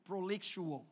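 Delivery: ordinary talk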